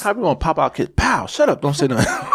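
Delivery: loud, high, laughing mock scolding. Parent popping a kid and ordering silence, mock scolding. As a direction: bark it like a hammed-up angry parent.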